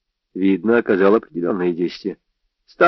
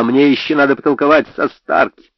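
shouted; 5 semitones higher, 4 dB louder than usual. A narrator's reading. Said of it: "закричал я".

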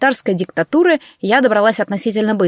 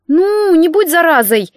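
slow, higher whiny plea; whining; 7 semitones higher, 3 dB louder, slower than usual. A narrator's reading. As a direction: whine it like a pestering little sister. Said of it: "проныла Женька".

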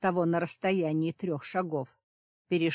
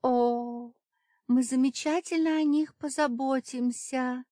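high, sweet singsong temptress; reverent singsong; 8 semitones higher, 4 dB louder than usual. A narrator's reading. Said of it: "благоговейно пропела".

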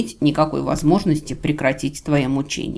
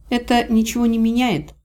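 higher-pitched, proud reply; mildly defiant dignity in a raised pitch; trying for dignity; 7 semitones higher than usual. A narrator's reading. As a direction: say it with dignity, refusing to sound pitiful. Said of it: "стараясь, чтобы это прозвучало не жалко, а с достоинством".

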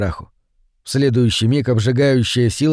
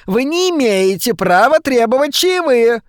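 put-on high, raised old woman's voice; indignant old woman; 11 semitones higher than usual. A narrator's reading. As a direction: demand it shrilly as the old wife. Old wife's indignant demand. (возмущённая старуха)

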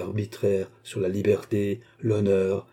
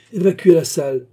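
higher, louder magistrate's command; loud order; 8 semitones higher, 8 dB louder than usual. A narrator's reading. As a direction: order the whole court cleared. Magistrat fait vider la salle. (ordre lancé à voix forte)

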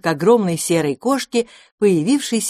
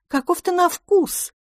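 predatory purr; 13 semitones higher than usual. Acted. Cat eyeing prey.

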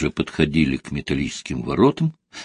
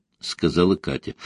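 ordinary delivery

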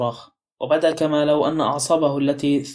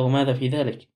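sharp. A narrator's reading. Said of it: "قال لومبرد بحدة".

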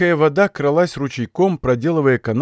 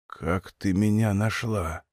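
weak, low, slow and much softer; deathbed whisper; 7 semitones lower, 8 dB quieter, slower than usual. Acted. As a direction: barely get the words out. Wounded man barely speaking.

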